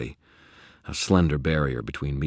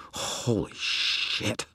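very slow, drawn-out whisper; breathed; 7 semitones higher, 4 dB quieter, much slower than usual. Acted. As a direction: stretch it out in an awed whisper. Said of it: "I breathed".

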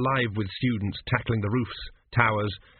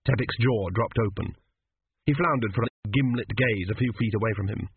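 ordinary delivery, nothing marked

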